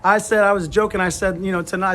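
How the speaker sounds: ordinary talk, nothing marked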